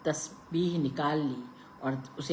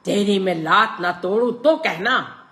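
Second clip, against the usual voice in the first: loud, raised threat; shouting threat; 5 semitones higher, 10 dB louder than usual. A narrator's reading. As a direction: bellow the threat. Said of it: "बाबू चिल्लाया".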